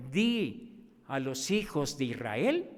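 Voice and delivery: low, slow divine command; the Lord commanding. Retold or imitated. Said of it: "la orden del Señor".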